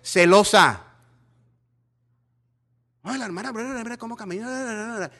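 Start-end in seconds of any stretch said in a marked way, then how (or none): 0.0-0.8 louder gossiping jab; loud mocking gossip label. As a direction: fling the word Celosa loud and scornful.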